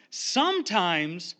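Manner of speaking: sometimes drawn out slowly, rising; stress on sometimes. Shown as a demonstration. Preacher to congregation, slow stretched stress on "sometimes". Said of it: "emphasis on sometimes".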